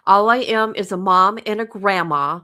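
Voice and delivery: ordinary talk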